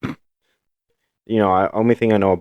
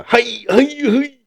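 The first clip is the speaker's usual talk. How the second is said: Bad Friends bit; much slower than usual. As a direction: squeal the hey like the podcast bit. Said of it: "making a reference from Bad Friends".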